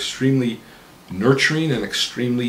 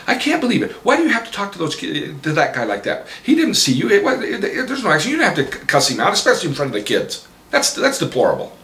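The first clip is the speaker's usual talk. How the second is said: a hint of a harping wife, higher; nagging wife; 10 semitones higher, 5 dB louder than usual. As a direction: nag him like an upset wife. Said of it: "my wife's right there harping on me".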